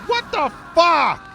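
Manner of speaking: drawn-out high yell of disbelief; real outraged what the fuck; shocked yell. Real, not acted. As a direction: scream it out, slow and stretched. Frustrated gamer shouting at game.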